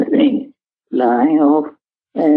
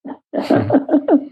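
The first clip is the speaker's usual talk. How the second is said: amused chuckle; 9 semitones higher than usual. Real, not acted. Amused about joyride on beach.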